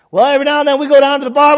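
mock excuse. Preacher mocking a churchgoer who still goes to the bar. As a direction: rattle off a lame excuse.

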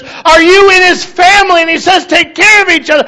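impassioned preacher. Retold or imitated. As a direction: preach it hard at the crowd. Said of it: "I heard a preacher one time".

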